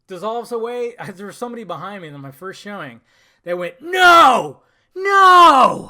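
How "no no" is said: very high, very loud drawn-out yell; imitated audience scream. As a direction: wail it out like a shocked moviegoer. Imitates moviegoer crying out.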